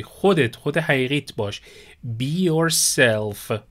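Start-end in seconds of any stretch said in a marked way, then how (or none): 2.2-3.6 slow and careful; slow model phrase. Shown as a demonstration. Teacher repeating be yourself for students, slowly. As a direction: draw it out once more.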